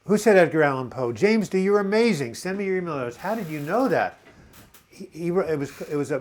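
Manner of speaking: high, loud, astonished congratulations; excited amazement. Real, not acted. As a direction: marvel at the quiz winner. Amazed a viewer answered his quiz.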